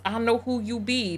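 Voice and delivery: normal talking voice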